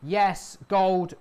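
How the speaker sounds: loud, high, jubilant yells of victory; excited cheering. Real, not acted. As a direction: cheer the winning trade out loud. Trade suddenly wins big.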